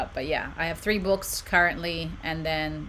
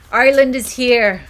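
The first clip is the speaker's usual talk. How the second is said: loud, higher fake Irish accent; terrible Irish accent; 5 semitones higher, 11 dB louder than usual. Streamer announcing an Irish arrival, terrible Irish accent. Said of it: "Irish accent. Terrible Irish accent".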